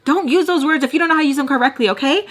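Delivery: mock-angry scolding; mad retort. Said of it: "It would make you so mad".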